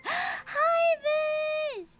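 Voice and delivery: high-pitched, delighted squeal of hello; real excited high hello; excited greeting. Real, not acted. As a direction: greet a friend who just dropped in, voice leaping up. Greets a friend arriving with raiders.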